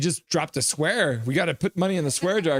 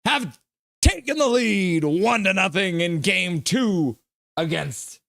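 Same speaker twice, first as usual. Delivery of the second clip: high, slow, drawn-out announcing; excited follow-up; 8 semitones higher, slower than usual. Real, not acted. Streamer announcing the Jets lead after the goal. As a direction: savour the lead, still buzzing.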